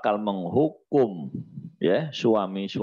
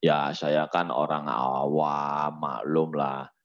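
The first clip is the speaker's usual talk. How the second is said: slow, low self-excusing drawl; mock someone hiding behind ignorance; a hint of a smug layman, slow and low; mocking lazy layman; 6 semitones lower, slower than usual. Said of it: "Orang sekarang kan".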